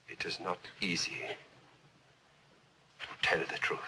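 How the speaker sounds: low, soft, near-whispered words; hushed admission. Acted. Witness finding it hard to tell the truth, hushed admission. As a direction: let it out quietly with heavy pauses. Painful quiet admission to court.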